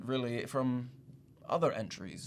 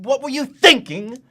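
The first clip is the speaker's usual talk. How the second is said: loud, high-pitched angry tirade; indignant outburst; 9 semitones higher, 10 dB louder than usual. Acted. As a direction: berate the applicant at full volume, outraged. Outburst berating the rejected applicant.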